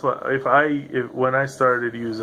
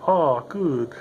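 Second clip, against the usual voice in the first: higher-pitched mock German "Oh, good"; imitated German accent; 4 semitones higher than usual. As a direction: put on a German teacher's accent. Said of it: "like, a German accent".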